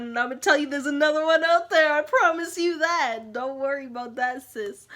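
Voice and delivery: loud, insistent reassurance; emphatic pep talk. Retold or imitated. Reassuring a friend about another guy, loud and emphatic. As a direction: build to a loud, stretched-out promise.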